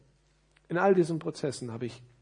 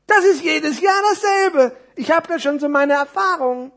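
high, loud know-it-all complaint; exaggerated high storybook voice; jaded little parcel; 14 semitones higher, 12 dB louder than usual. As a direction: grumble it in a tiny character's voice. World-weary small parcel answers.

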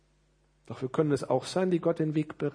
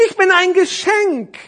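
very high, loud, slow character voice; high-pitched, stretched-out puppet voice; little parcel; 14 dB louder, slower than usual. Acted. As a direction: announce it as a tiny storybook figure. Small parcel in the story.

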